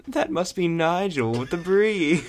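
high, loud, drawn-out quote; acted movie quote. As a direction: exclaim it slowly like a movie character. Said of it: "usually a quote from the movie".

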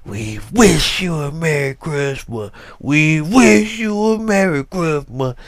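mock-angry children's voice, leaning on "wish"; angry carol. Imitating the kids' church choir, barely audible but angry. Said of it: "but it was angry".

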